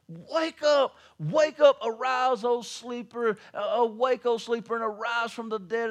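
high, loud preacher's call to wake up; loud high revival preaching; fiery preaching. Acted. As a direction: thunder the scripture at the congregation. Preaching scripture at full force.

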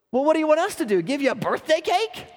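high, loud mocking retort; mocking sneer. Prostitutes mocking their friend's birthday: loud scoffing sneer. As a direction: scoff at her like a crude diner regular.

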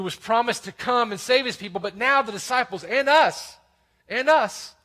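preacher tells congregation of the finished work, impassioned; high, forceful pulpit proclamation; impassioned preaching. Real, not acted. Preacher proclaims the gospel to all, impassioned preaching.

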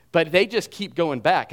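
ordinary talk, nothing marked

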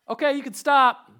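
high, loud call like a drill instructor; instructor's shout. Retold or imitated. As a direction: call it out across the field. Instructor calls out to the runners.

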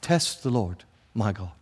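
lightly hammed-up pious refusal; a hint of sanctimonious posing; mock piety. Ahaz suddenly remembering Deuteronomy, mock pious. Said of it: "So he suddenly remembers this, like".

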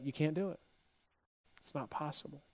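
very soft, low discouragement; hushed naysaying. Retold or imitated. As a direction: drop almost to nothing as the doubters. Voicing doubters after a loud climax.

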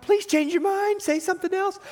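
high-pitched mock pleading. Peter silently begs Jesus to change his mind, high pleading. Voicing Peter's unspoken plea.

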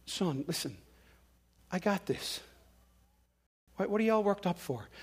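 unruffled dad. Acted. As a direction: settle a worked-up kid, quietly and unhurried. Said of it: "and dad just goes".

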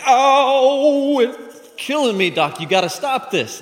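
high, loud drawn-out howl easing into pleading; hammed-up pained outcry; mock agony. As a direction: wail and beg the doctor to stop. Patient touches neck in pain.

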